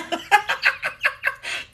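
high airy giggles between greetings. Real, not acted. Host giggling while welcoming viewers. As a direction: giggle through the hellos.

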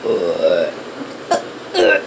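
string of low rumbling belches; repeated burps. Acted. Character who can't stop burping, repeated low burps. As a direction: keep burping, unable to stop. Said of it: "I can't stop burping".

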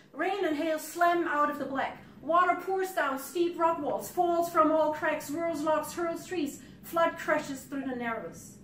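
loud, high, driving recital; dramatic poem reading. Acted. Poet describes the flash flood hitting, loud driving recital. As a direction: hammer out the verse like a torrent.

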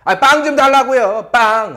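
loud, high, pushy demand for bread; insistent demand. Retold or imitated. Student loudly demanding bread from the flight attendant. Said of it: "그래서 한국말로".

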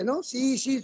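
normal talking voice

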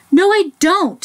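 high-pitched, stubborn bear's denial. A narrator's reading. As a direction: dig in and protest, voice jumping up. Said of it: "said the Big Smelly Bear".

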